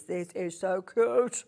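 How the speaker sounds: slow, drawn-out cutesy gushing. Real, not acted. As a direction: coo over something adorable, stretching it out. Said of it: "voice when it goes cute".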